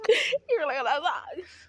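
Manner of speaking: laughing through the words; cracking up while talking. Real, not acted. Laughing at own socks.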